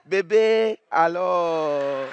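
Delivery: put-on drawn-out, fond call of baby; imitating a couple's tender pet name; mock lover's call. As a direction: coo it like a lover calling his sweetheart. Repeats the couple's phrase.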